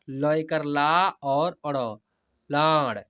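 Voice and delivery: very slow teacher's demo, long stretched vowels; slow spelling-out. Shown as a demonstration. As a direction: spell each letter slowly, then draw out the whole word. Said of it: "ऐसे, ठीक है?". (धीरे-धीरे हिज्जे करके, स्वर खींचकर)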